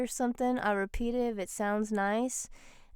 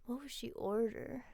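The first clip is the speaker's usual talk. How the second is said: ordinary talk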